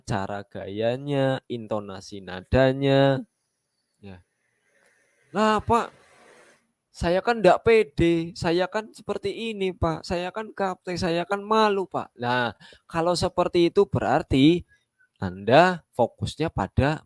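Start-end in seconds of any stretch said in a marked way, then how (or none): 5.3-12.1 whiny excuse-maker. Retold or imitated. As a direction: whine out every excuse like an insecure newbie. Downline's excuses, mocked.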